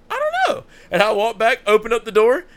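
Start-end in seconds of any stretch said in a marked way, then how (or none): 0.1-0.6 high clueless squeak. Retold or imitated. Answering ex about Josh, clueless.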